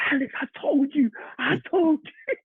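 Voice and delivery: be the teammate screaming down the touchline; imitated teammate's ecstatic yell. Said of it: "He's running down the touchline".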